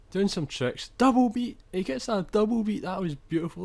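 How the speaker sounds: rising to a high, loud yelp on double beat, then easing back; excited commentary. Real, not acted. Gamer watching his own double beat skill, excited shouting. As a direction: call the skill move like a thrilled commentator.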